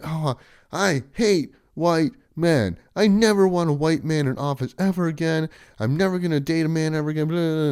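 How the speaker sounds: mocking quote. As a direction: caricature a ranting TikToker. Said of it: "they proceed to say stuff like".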